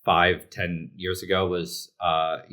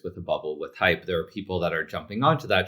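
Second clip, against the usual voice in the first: usual speaking voice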